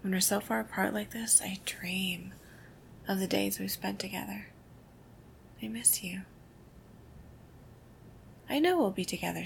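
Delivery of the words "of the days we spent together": ordinary delivery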